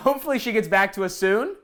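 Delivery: ordinary talk, nothing marked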